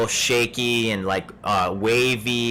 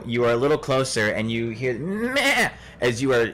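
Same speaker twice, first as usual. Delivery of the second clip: ordinary delivery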